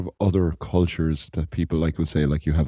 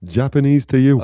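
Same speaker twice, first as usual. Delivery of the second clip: much higher, louder outraged shout; hammed-up Dublin tough squaring up; loud mock outrage at the question; mock angry challenge; 10 semitones higher, 8 dB louder than usual. Acting an offended Dublin tough.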